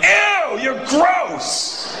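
high, drawn-out cry of disgust; mad disgust. Retold or imitated. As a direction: recoil with a high, revolted whine. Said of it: "got mad".

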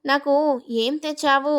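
ordinary delivery

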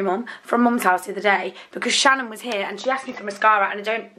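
usual speaking voice

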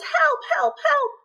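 high, panicked cries of help; crying for help. Acted. Ants caught in the rain cry for help, high and panicked. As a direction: squeak out help like tiny drowning ants. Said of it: "cried the ants".

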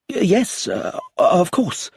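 high, slow, stammered yes; stammering tutor. Acted. Nervous tutor answering the inspector, high and stammering. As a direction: trip over the words in fear.

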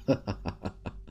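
soft, high snicker to oneself; quiet breathy chuckle in a quick run; derisive laugh. Acted. Starter host alone finding leftover Bulbasaurs, derisive laugh.

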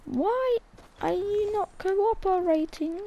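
drawn-out frustrated whine; whining at a build that won't place; exasperated whine. Real, not acted.

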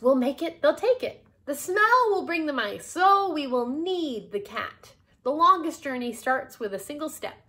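loud, high, emphatic planning; rousing conviction. Acted. Miss Tilly laying out her cheese plan, rousing. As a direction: build the plan out loud with gusto.

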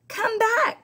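high, loud cry. Acted. Wife crying out after the runaway cookie, high loud call. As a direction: call after him in alarm. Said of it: "cried his wife".

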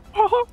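high squeaky cartoon chuckle; Mickey Mouse laugh. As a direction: imitate a high-pitched mouse giggle. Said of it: "from Mickey Mouse with a".

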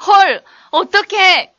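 high, loud exclamations of shock; mock shock. Shown as a demonstration. Teacher replaying skit phrases for translation, loud mock shock. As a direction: burst out in acted dismay.

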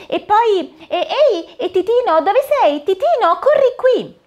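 a cheerful fairy godmother casting a spell; fata smemorina. Acted. Fairy voiced in story. (voce alta e allegra da fata madrina)

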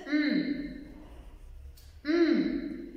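humming "Mm" as a sustained hum through closed lips; a long nasal "Mm" hum; letter M sound. Shown as a demonstration. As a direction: hum "mm" clearly, showing the sound of M. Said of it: "Lo repasas y dices".

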